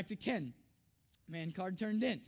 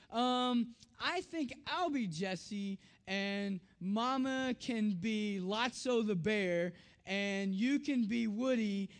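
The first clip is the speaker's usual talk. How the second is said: slow, drawn-out imitation of a child deciding; slower than usual. Daughter assigns family their characters, slow and deliberate. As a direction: stretch each phrase out like a kid assigning parts. Daughter slowly handing out the roles.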